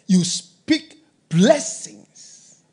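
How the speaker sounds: slow, weighty words; drawn-out emphasis. Real, not acted. Preacher to congregation, slow and emphatic. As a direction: lean hard on each word. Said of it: "explaining the verse slowly".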